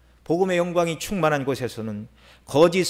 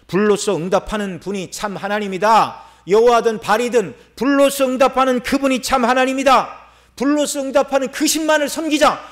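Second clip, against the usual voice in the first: proclaimed challenge; 6 semitones higher, 5 dB louder than usual. Acted. Elijah challenges Baal's prophets, proclaimed with rising pitch. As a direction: voice climbing into a high proclamation.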